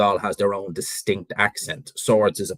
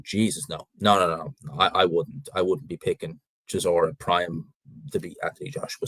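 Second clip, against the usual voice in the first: ordinary delivery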